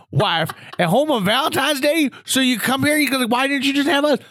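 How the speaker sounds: put-on high-pitched scandalized old-timer; hammed-up incredulous old man; Gramps' voice. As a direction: scold like an appalled grandpa, voice way up. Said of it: "Gramps Grant's talking like this".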